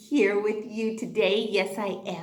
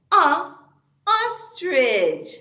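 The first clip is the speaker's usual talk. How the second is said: ordinary talk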